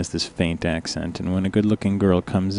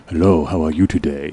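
put-on low, smooth voice with a faint accent; Richard's low voice; 3 semitones lower, 4 dB louder than usual. Coworker leaning over to charm her in a low voice. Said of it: "his voice is really low".

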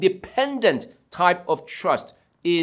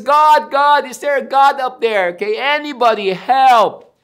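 raised, louder call upward; calling on God; 5 semitones higher, 5 dB louder than usual. Acted. Frightened climber calls up to God, acted desperate shout. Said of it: "he started to call on God".

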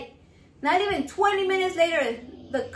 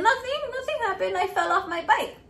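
put-on child's denial; 6 semitones higher than usual. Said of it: "And I said".

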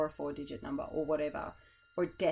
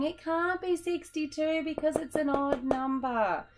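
put-on much higher, slow sing-song child voice; a chorus of kids, hammed-up and high; silly voice; 9 semitones higher, slower than usual. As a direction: ham up a know-it-all kid, high and drawn out. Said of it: "Maybe not in that voice".

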